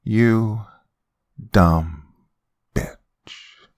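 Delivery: word by word, with long pauses; slow, deliberate insult, trailing to near silence. A narrator's reading. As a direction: draw each word out and let it hang. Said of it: "She said the next word slowly".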